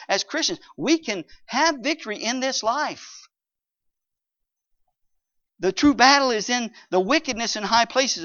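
high, loud, driving preacher voice; fiery preaching. Acted. Pastor exhorting the congregation, in full preaching force. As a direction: pound the scripture home to the congregation.

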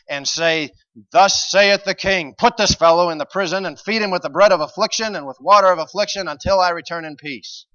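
high, booming king's proclamation; shouted royal decree. Acted. King condemning the prophet to prison, shouted. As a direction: bellow the sentence like a ruler.

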